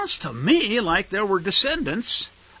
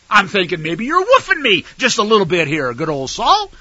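sarcastic disbelief; 5 semitones higher, 5 dB louder than usual. Acted. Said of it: "I'm paraphrasing a bit".

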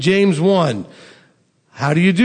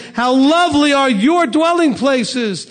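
slow, higher, lifted reading of the psalm line; a touch of joyful exclamation; joyful cry; 7 semitones higher than usual. Shown as a demonstration. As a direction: read it as a glad cry of longing. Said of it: "This is coming out of a, a joyful cry".

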